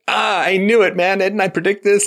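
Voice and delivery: high, loud mocking whine of vindication; mock told-you-so. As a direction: ham up the self-pitying guy proving himself right. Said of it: "then he's like".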